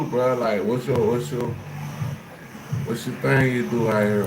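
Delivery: ordinary talk, nothing marked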